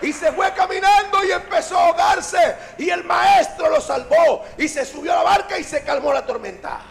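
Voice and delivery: high, loud, emphatic retelling; full-force preaching. Acted. Evangelist who cannot forget Pedro's story, high shouted proclamation. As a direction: proclaim every event at the top of your range.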